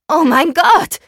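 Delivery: high, loud outburst of disbelief; shrieking. A narrator's reading. Friend learns of the secret birthday, shrieking. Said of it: "schrie sie".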